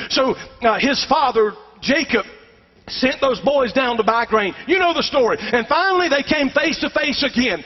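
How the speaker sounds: ordinary talk, nothing marked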